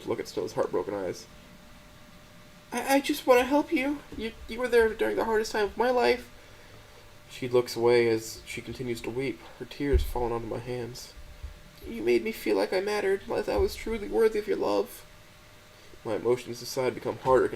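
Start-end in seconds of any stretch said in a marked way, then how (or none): 2.7-6.3 put-on a crying girlfriend pleading to help; high, gentle, tearful voice; tearful Stella. A narrator's reading. Stella's voice in the story. 11.9-15.0 put-on a crying girlfriend pleading to help; high, gentle, tearful voice; tearful Stella. A narrator's reading. Stella's voice in the story.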